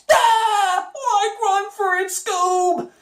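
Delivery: high, slowish Shaggy cry; Shaggy voice, second take. Shown as a demonstration. Second demo take, high Shaggy crying out to Scooby. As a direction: squeak it up like a panicked slacker. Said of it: "the voice changed a little bit".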